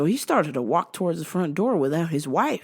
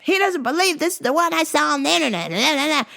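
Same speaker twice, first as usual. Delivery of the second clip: put-on high old wife voice; 10 semitones higher than usual. Maggie arguing with her husband, high stubborn insisting.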